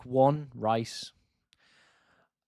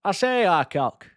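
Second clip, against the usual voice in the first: higher, hammed-up Yorkshire accent; mock Yorkshire accent; 7 semitones higher, 3 dB louder than usual. Yorkshire streamer mocking his own accent to chat. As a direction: lay the Yorkshire accent on thick for fun. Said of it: "That's how we all speak".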